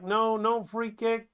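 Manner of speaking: high, slow annoyed insistence. Acted. Robot referee refusing the free kick, annoyed. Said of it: "she gets annoyed".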